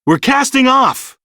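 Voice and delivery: high, loud call across the ship. A narrator's reading. Peter calling to the crew, high and loud. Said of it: "Peter called".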